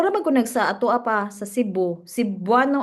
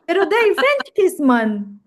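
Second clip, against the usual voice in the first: higher, worked-up objection; animated teasing protest; 7 semitones higher, 4 dB louder than usual. Real, not acted. Friend objects to French kissing a friend, animated teasing protest. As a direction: protest it in a high, excited voice.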